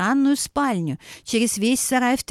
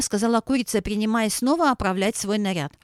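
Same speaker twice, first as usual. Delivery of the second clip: ordinary delivery, nothing marked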